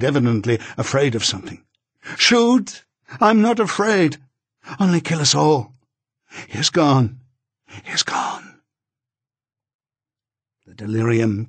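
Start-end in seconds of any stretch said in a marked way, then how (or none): 2.2-7.2 high, loud frightened cry; delirious scream. A narrator's reading. Delirious wife facing an imagined gun, screaming. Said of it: "she screamed, evidently out of her head". 7.7-8.6 a faint, breathless whisper. A narrator's reading. Delirious dying wife, whispering to herself.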